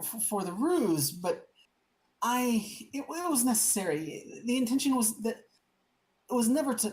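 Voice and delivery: high stammering, sheepish excuses; high flustered noble voice, stumbling over words; flustered councilman. Acted. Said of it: "It's like".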